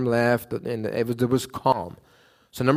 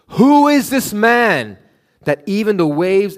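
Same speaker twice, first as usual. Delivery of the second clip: amazed awe; 7 semitones higher, 7 dB louder, slower than usual. Acted. Disciples amazed after the calm.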